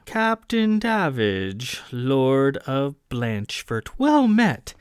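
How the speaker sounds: unpleasant Remnath drawl. Acted. Adjutant greets the captain in a haughty Remnath drawl. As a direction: drawl it out in Remnath, looking down on him. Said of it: "in a somewhat unpleasant Remnath drawl".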